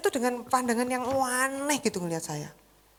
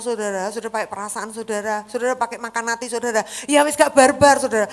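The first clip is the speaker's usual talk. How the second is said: normal talking voice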